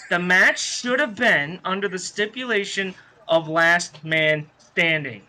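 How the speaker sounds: ordinary delivery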